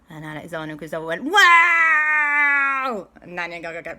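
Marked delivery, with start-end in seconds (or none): 1.2-3.1 long, loud, high-pitched "wow"; children's awestruck holler, acted out at full volume; amazed shout. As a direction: draw out a big amazed cry. Amazed children voiced in story.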